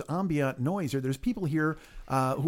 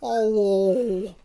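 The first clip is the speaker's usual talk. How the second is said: creepy hello; 6 semitones higher, 5 dB louder than usual. Acted. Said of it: "Take it there".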